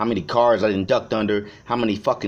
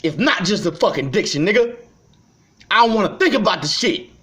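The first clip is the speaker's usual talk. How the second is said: lashing out, voice high and loud; angry rant; 8 semitones higher, 3 dB louder than usual. Real, not acted. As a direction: pitch climbing into a furious yell. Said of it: "I'm going off and melting down".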